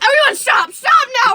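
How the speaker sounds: high, loud alarmed shouting; retelling a friend's panicked shout; panicked order. Retold or imitated. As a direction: yell at everyone to freeze. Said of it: "Adrian's like".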